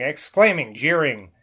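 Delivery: plain reading voice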